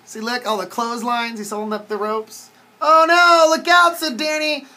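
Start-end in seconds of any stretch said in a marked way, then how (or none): 2.8-4.0 high, loud storybook cry; alarmed warning. A narrator's reading. Danny warns the dinosaur about the ropes, alarmed cry.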